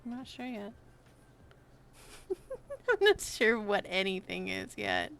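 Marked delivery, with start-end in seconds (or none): 2.0-2.8 airy unvoiced laugh; breathy giggle. Real, not acted. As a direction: giggle softly under breath. Laughs at own model.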